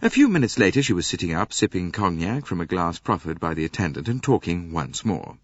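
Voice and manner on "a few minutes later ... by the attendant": ordinary delivery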